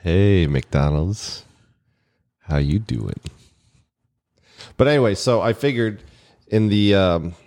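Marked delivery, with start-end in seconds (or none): 0.0-3.2 slow suave voice. Pretend. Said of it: "was he nice and gentle to you".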